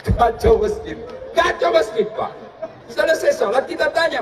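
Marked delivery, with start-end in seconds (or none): none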